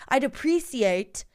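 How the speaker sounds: over-pronounce in mock royal-family English; cut-glass English accent. Host as the patient making a request, cut-glass English accent. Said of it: "it's called a cut glass English accent".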